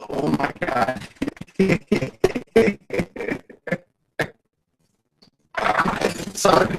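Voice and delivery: usual speaking voice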